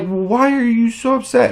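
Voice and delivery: high-pitched mimicry of her prying; put-on high, needling question; mocking woman's voice. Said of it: "And she's like".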